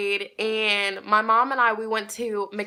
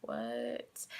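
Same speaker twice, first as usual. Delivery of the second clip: a low, soft, baffled "what"; confused; 11 semitones lower, 15 dB quieter than usual. Retold or imitated. Little girl hearing a stranger claims her, low confused mumble. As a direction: drop it low and quiet, lost. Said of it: "I was just confused".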